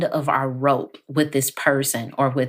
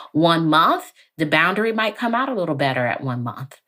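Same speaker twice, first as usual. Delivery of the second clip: normal talking voice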